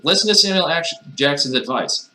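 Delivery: high, pressing comic urging; insistent. Acted. Player urging sleep on Jackson's advice, insistent.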